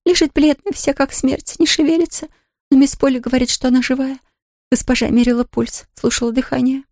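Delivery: a worried servant woman; maid voice. A narrator's reading. Nancy voiced by reader.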